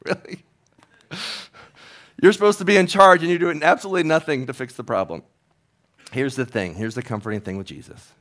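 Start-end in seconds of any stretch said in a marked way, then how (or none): 0.0-5.2 high, loud incredulous scolding; mock outraged scolding, much higher and louder; exasperated retort. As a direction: let the manager have it. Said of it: "I was like".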